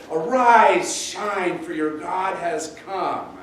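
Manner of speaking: loud, high opening call, then easing; ringing call to rise, slowing at the end; scripture proclamation. Acted. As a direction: proclaim it like a rousing prophet. Quoting scripture as a rousing proclamation.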